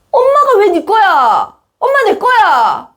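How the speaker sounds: put-on a grown dad whining like a kid; childish dad. Immature dad squabbling with child over mom, whiny. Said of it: "이렇게 말하는 철부지 없는 아빠들".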